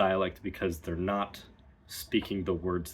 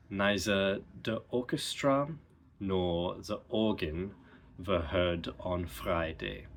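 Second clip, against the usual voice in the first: German accent example. Shown as a demonstration. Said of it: "The example sentence".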